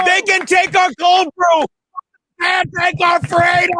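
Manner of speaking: rally the troops over a coffee; a Braveheart-style cry about cold brew; mock heroic rally cry. Mock heroic speech on subway.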